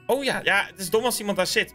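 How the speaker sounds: ordinary talk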